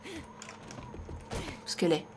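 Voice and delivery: chuchotement lent, sans voix; chuchote. Real, not acted. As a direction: souffle la question, aux aguets. Cherche une zombie discrètement. (chuchote lentement)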